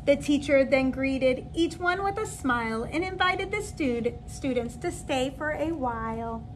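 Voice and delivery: lilting, sing-song storybook read; reading to children. Acted. Teacher reading a picture book to her new kindergarten class, lilting and gentle. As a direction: read it aloud like a bedtime story for little kids.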